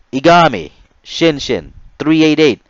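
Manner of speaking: loud, high roll-call bark; mimicking the RDC. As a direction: call it out like the drill instructor. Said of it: "as he mimicked the R D C".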